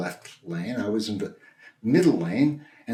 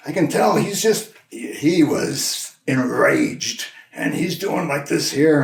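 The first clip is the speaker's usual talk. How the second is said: worked-up, higher-pitched telling; mock enraged; 4 semitones higher, 3 dB louder, slower than usual. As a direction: act out someone else's rage, drawn out. Imitating an enraged driver's ranting.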